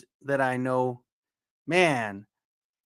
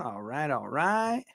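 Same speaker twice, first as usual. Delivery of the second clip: usual speaking voice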